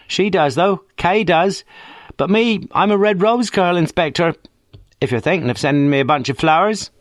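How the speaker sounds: put-on cheeky working-class girl on the phone; brash young woman. A narrator's reading. Friday Banks answers the inspector, cheeky and common.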